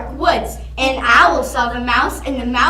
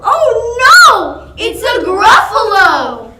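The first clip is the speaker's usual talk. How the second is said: much louder and higher alarm; frightened cry; 9 semitones higher, 10 dB louder than usual. Acted. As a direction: yell as if the monster is right there. Sees the Gruffalo.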